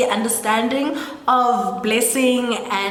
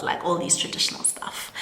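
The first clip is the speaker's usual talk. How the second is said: hushed secret; 4 semitones lower, 8 dB quieter, faster than usual. Acted. Husband secretly asking, hushed.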